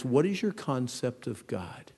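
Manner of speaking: ordinary delivery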